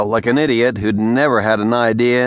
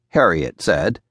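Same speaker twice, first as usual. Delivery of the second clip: usual speaking voice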